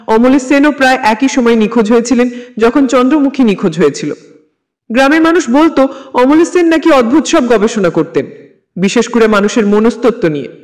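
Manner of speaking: usual speaking voice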